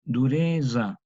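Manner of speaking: dureza with a buzzing voiced z, like Italian zio; voiced old Spanish z. Shown as a demonstration. Teacher modelling old Spanish dureza. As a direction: buzz the z in dureza. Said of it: "se pronuncia como una cuasi D S".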